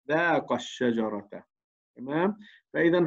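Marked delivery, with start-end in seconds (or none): 0.1-1.3 "ذاق الشجرة" read slowly, the alif of "ذاق" dropped; correct pronunciation. Shown as a demonstration. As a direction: say "ذاق الشجرة" as one linked phrase. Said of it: "هذا اللفظ الصحيح".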